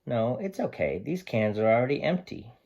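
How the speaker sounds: lively picture-book read-aloud; reading to children. Acted. Teacher reading Sam the dog's story to her class, lively and expressive.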